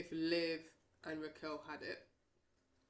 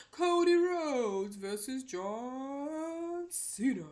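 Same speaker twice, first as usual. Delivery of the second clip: slow, drawn-out call, louder and higher; ring announcer call; 7 semitones higher, 8 dB louder, much slower than usual. Pretend. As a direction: stretch every name out like a ring announcer. Announcing the main event match.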